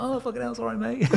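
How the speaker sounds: very high, laughing sheepish sorry; laughing apology. Retold or imitated. Recruiter admits it laughing.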